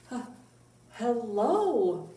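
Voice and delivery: ordinary talk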